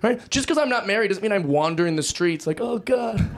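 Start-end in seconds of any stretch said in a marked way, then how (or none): 2.6-3.3 hammed-up lovelorn moan; mock lonely lament. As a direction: whine like a lonely guy yearning for chores. Mock lonely single man lamenting.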